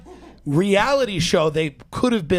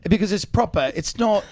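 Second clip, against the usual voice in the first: British accent; 2 semitones higher than usual. Shown as a demonstration. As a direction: tease coyly in a refined British accent. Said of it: "Can you do a British accent?".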